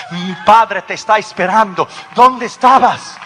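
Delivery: building to a loud, high demand; urgent reproach. Acted. Guard to the awaited pastor, urgent loud reproach. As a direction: scold someone long awaited, voice rising.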